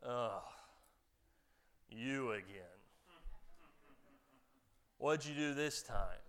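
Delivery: low, fed-up principal grumble; weary principal. Retold or imitated. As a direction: sigh at the kid like a tired principal. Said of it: "the principal looks at you and says".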